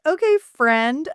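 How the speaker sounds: put-on girlish giddy squeal; giggly okay friend. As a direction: coo it, delighted to be friends. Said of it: "she's like".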